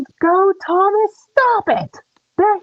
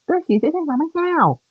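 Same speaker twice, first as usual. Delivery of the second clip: ordinary talk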